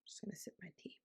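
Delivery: barely audible, voiceless whisper; whispered aside. Real, not acted. Podcaster pausing to sip tea, whispering. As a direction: whisper it off to yourself.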